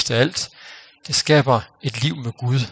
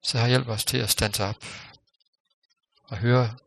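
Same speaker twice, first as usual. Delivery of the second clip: closing prayer. Real, not acted.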